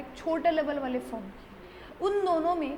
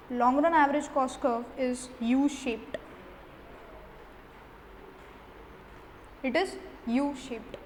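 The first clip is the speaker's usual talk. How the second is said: slow, word-by-word dictation pace; slow note dictation; much slower than usual. Acted. Teacher dictating notes to students, slow and deliberate. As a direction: spell it out so students can write it down.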